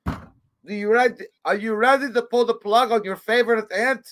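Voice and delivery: mock-anguished plea; mock dramatic question. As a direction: ask it like an impossible hostage choice. Mock agonized question quote.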